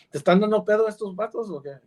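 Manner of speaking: quick slangy Mexican Spanish aside. Retold or imitated. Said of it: "excuse my language here, my slang".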